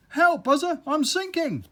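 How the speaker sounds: high, loud desperate cry; panicked plea. Acted. Earwig begging the bee for help while sinking, high and loud. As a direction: beg for rescue as you sink.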